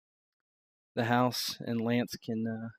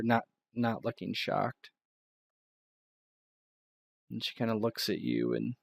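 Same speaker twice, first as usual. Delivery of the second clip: ordinary delivery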